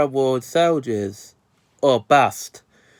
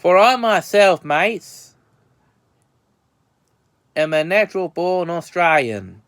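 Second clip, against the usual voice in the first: fake Aussie accent. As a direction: claim to be a true-blue Aussie in a cheesy accent. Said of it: "what accent I'm using here".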